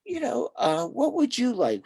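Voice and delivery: gentle question. Retold or imitated. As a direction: lift the voice to coax him. Counselor quoting question to husband.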